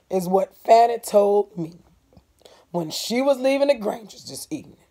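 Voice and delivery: a neighbour bringing bad news; voicing Mister Avery. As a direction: speak as a grave old farmer.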